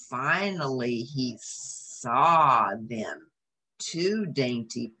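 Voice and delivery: ordinary delivery, nothing marked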